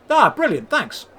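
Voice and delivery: pleased to get the answer; a burst of glad thanks; delighted thanks. A narrator's reading. Hero thanks shopkeeper.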